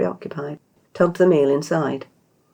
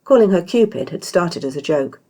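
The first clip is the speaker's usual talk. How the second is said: ordinary delivery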